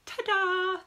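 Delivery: high, bright showman's ta-da; ta-da reveal. Real, not acted. Author unveiling her children's book to viewers, excited ta-da. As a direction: present it like a magic trick.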